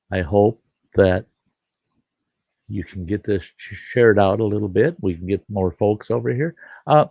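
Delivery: ordinary talk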